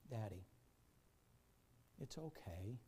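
quiet, tender acted reassurance; hushed reassurance. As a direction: gently comfort a parent, nearly whispering, with long pauses. Retold dying girl reassures her father.

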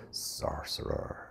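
hushed, voiceless whisper of sorcerer; a secretive murmur behind his back; muttered. Acted. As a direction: breathe the word like townsfolk muttering.

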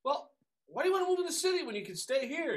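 high, mock-worried mother pleading; a mother coaxing her son to stay home; mother's voice. Mother to son about moving, high and pleading. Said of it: "My mother said".

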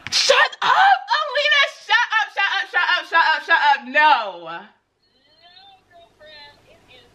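high laughing shouts of joy; joyful shrieks at the realtor's news; ecstatic shrieking. Real, not acted. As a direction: scream with delight, over and over. Ecstatic reaction to good news.

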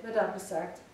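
ordinary delivery

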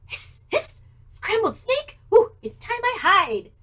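scared snake. Acted.

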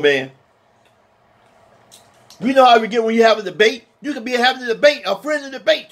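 ordinary talk

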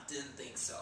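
normal talking voice

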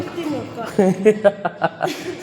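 real laugh, a long string of ha-has; hearty laugh. Real, not acted. Laughing during banter in a gym session, hearty laugh.

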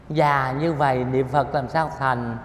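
normal talking voice

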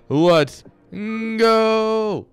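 high, loud, drawn-out hype call; hype shout. Pretend. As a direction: launch the match with a long shout. Caster hypes the next series.

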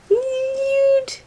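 very high-pitched squeal of delight; excited squeal. Real, not acted. Cooing over a cute gift, high squeal.

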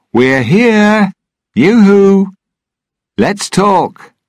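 very high, loud hailing call; yoo-hoo across the water to another ship; shouting. A narrator's reading. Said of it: "Jack shouted".

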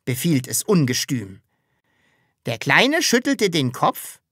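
put-on lilting children's poem delivery; poem recital. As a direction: declaim a nonsense poem in a character voice.

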